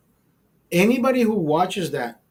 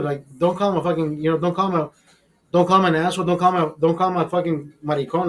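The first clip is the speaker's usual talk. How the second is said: ordinary talk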